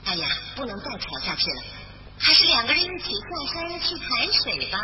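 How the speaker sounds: plain reading voice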